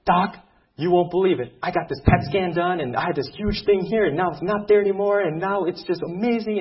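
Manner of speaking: imitating a thrilled patient, high and loud; excited patient. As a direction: gush out the news, loud and high-pitched. Patient retells his good scan news.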